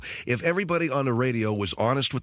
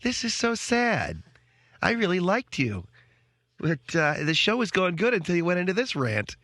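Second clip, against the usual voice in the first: imitating Melissa; 7 semitones higher than usual. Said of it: "She's in there going".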